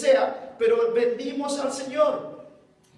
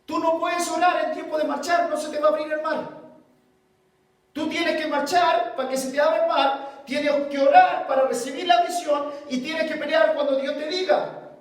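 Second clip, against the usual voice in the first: loud, higher preaching; full-force preaching; 5 semitones higher, 4 dB louder than usual. Acted. Pastor rebuking the congregation, loud preaching. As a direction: preach it hard to the church.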